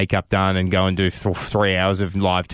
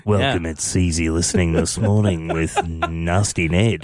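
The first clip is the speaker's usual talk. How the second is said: radio announcer; 5 semitones lower than usual. Pretend. Mock late-night radio host intro.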